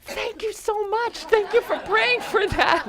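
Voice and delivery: very high, drawn-out squeal of thanks; hammed-up excited squealing; imitated screaming girls. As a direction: gush it slowly in a high girlish squeal. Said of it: "these girls were screaming".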